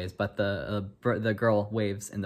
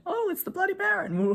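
much higher squeal of surprise; startled exclaim. Acted. Student spots the Bloody Baron, high startled squeal. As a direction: yelp it out as he appears.

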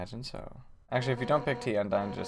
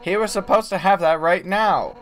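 loud, raised-pitch insistence; urgent outburst; 5 semitones higher, 8 dB louder than usual. Acted. Host suddenly remembering a cooking lesson, loud insistence. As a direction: burst out as if the lesson can't wait.

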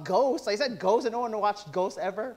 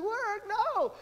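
lazy refusal; 13 semitones higher, 3 dB quieter than usual. Retold or imitated. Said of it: "we're like".

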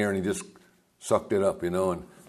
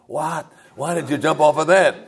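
higher, shocked disbelief; mother's alarmed question; 6 semitones higher than usual. Retold or imitated. Mother hearing her son jumped off the second floor, shocked. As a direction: gasp it out like a startled mother.

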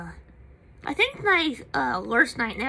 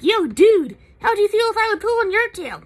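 put-on raised, higher, louder scolding a kid; indignant yell; 9 semitones higher, 9 dB louder than usual. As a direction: tell the kid off loudly. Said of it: "and I was like".

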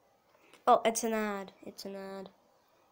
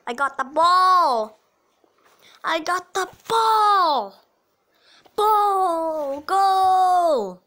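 goal celebration; 3 semitones higher, 4 dB louder, much slower than usual. Real, not acted. Chants as they score a goal.

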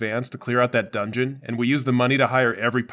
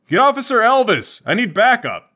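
shouting for backup; 10 semitones higher, 5 dB louder than usual. Acted.

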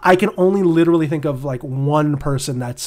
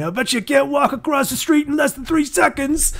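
over-the-top mock New Jersey accent; 10 semitones higher than usual. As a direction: yell it like a cocky New Jersey guy daring his friend. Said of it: "Do you like my New Jersey accent".

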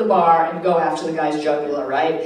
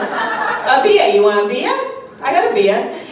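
beer said as bee-ah, pushed loud and higher; a Rhode Island server repeating herself louder at confused customers; Rhode Island accent, louder; 5 semitones higher, 5 dB louder than usual. Retold or imitated. Server repeating the order to confused customers, in a louder Rhode Island accent. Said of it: "Rhode Islander".

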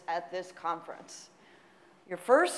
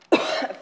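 short loud cough mid-sentence; a sudden cough that breaks the line; real cough; 5 semitones higher, 9 dB louder than usual. Real, not acted. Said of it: "cough in the lecture".

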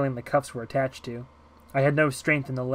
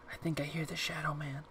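put-on very soft little-girl whisper; fearful whisper; 2 semitones higher, 13 dB quieter than usual. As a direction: whisper so he won't hear you. Girl hears a feared intruder.

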